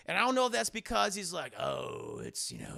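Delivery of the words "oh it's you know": very low, croaked murmur; put-on villain voice. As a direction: drop far down in pitch. Slips into Palpatine's changed voice.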